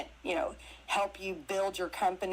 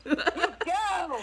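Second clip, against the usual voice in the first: higher laughing "you go"; cheering; 8 semitones higher, 4 dB louder than usual. Real, not acted. Cheering Kimmy on with a high laughing call. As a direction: egg her on, laughing.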